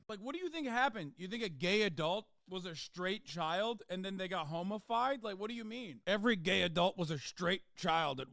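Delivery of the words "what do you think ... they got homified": ordinary talk, nothing marked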